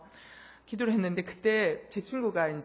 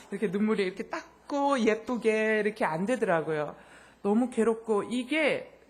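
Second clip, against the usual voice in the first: normal talking voice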